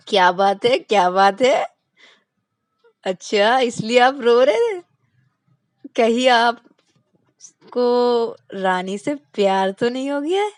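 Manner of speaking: loud, playful, drawn-out insinuation; hint slyly he's in love; sly teasing. Acted. Rahul teasing Vikas that he loves Rani, loud and sly.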